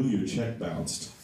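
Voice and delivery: usual speaking voice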